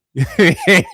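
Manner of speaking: brief gloating laugh; short laugh. Real, not acted. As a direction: burst out with a quick laugh. Laughs at man's plight.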